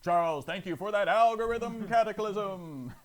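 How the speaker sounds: booming host thank-you; announcer voice. Acted. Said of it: "Thought I had to do the voice again".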